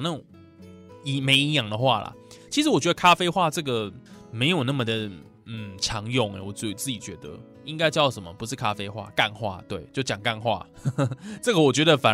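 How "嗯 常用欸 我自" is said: normal talking voice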